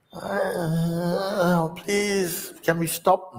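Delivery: hammed-up learner begging to stop; mock crying plea. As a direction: whimper like you're about to cry. Learner near tears.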